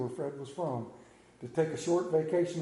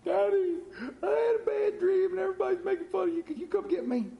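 put-on high, quick little-kid voice; child on the phone; 13 semitones higher than usual. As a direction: plead like a scared kid calling home. Child calling dad at night.

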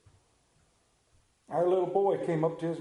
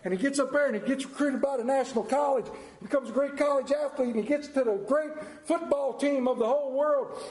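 building excitement; 9 semitones higher than usual. A narrator's reading. Preacher telling the congregation the touchdown, rising to a shout. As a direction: call the winning run like a stadium announcer.

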